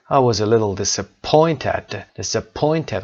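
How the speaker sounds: slow model, POINT stressed in disappointed. Shown as a demonstration. Teacher reading an answer aloud, stress on POINT in disappointed. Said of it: "قسمت point".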